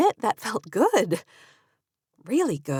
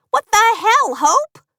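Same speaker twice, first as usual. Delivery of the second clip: outraged shout; 9 dB louder, slower than usual. Acted. Katie outraged at the name.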